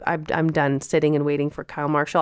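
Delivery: ordinary talk, nothing marked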